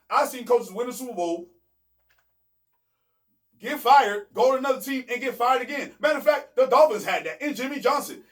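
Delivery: heated rant. Real, not acted. Heated rant about fired coaches.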